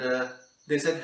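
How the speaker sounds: usual speaking voice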